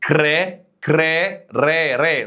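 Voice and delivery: "كري" said as an isolated kreh with rolled r; syllable model. Shown as a demonstration. Teacher prompts the student, "كري" sounded out as a held kreh. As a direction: hold "كري", then echo its ending "ري" as reh. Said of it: "هنا هتقول ايه؟".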